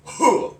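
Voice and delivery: sharp high karate huh; karate grunt. Shown as a demonstration. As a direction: punch out a short hah. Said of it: "they're gonna go".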